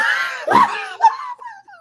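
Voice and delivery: sudden high, loud burst of laughter. Real, not acted. Telling the hosts about the drunk driver, bursts out in loud, high laughter. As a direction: crack up hard mid-sentence. Cracks up mid crash story.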